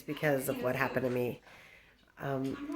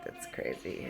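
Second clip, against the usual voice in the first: low hushed aside; real whisper; 13 semitones lower, 10 dB quieter, slower than usual. Real, not acted. Roommates fight nearby, she whispers to camera. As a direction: whisper it under the noise.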